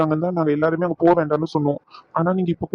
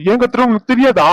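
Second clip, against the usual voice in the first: much higher and louder repeat; angry shout; 6 semitones higher, 5 dB louder than usual. Acted. Husband repeats the question to wife, shouting. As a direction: blow up on the repeat.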